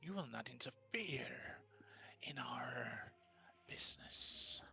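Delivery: menacing spirit whisper; a merciful but threatening spirit whispering. Acted. GM voices the spirit.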